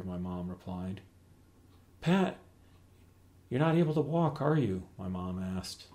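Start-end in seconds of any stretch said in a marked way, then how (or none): 2.0-4.8 worried. Acted. Mom alarmed dad can't walk.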